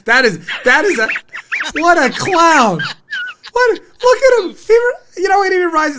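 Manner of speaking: high, loud, cracking with laughter; host cracks up over the clip, loud and high; laughing disbelief. Real, not acted. Host laughing at the anchor's meltdown, high and loud.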